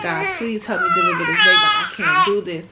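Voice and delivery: high, loud crying prayer to God; desperate sobbing plea. Retold or imitated. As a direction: beg God through tears. Retold desperate prayer in labor.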